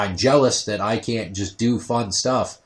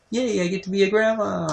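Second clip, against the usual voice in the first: mock cheer; 10 semitones higher than usual. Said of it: "I was like".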